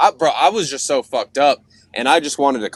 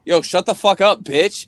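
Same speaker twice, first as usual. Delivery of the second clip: sarcastic; 6 semitones higher, 4 dB louder than usual. Retold or imitated. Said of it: "in, like, the most sarcastic".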